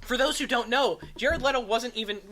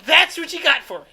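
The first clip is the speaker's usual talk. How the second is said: higher and louder, teasing disbelief; mock indignant; 4 semitones higher, 9 dB louder than usual. Real, not acted. Host teasing his cohost about a feeble laugh, mock offended. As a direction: act offended at the weak laugh. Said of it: "You said laughs are impossible, so I laughed".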